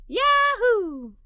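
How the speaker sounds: loud, high happy cheer; excited yahoo. Acted. Girl cheering at the chance to make history, happy whoop. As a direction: whoop it out with delight. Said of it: "I responded with another".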